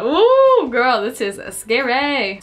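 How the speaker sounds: a delighted squeal of fright; excited ooh. Real, not acted. Reacting to a scary scene.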